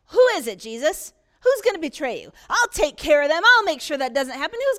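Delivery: loud, protective outburst; loud eager Peter. Acted. Peter to Jesus, loud and eager. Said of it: "Peter then speaks up".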